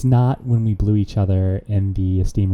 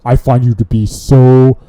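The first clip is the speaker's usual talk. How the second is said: louder, whiny complaining coworker voice; a coworker griping in a put-on voice; wrong put-on voice; 8 dB louder, slower than usual. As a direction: put on a different voice to gripe about a coworker. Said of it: "I was so focused on the voice".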